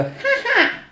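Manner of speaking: a high cackling "Ha ha!" from the one-toothed woman; mock cackle. Retelling a mocking old woman's cackle over the crash victim. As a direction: crow it like a gleeful old woman. Woman laughing at injured man.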